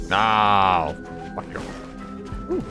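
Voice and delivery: ordinary talk, nothing marked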